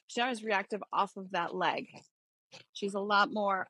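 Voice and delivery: normal talking voice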